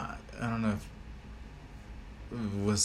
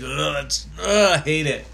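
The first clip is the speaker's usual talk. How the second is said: wincing, grossed-out reaction; squeamish cringe; 4 semitones higher than usual. Retold or imitated. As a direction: recoil with an acted "ugh". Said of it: "I, like, grab my shit right away".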